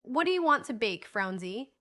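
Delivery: usual speaking voice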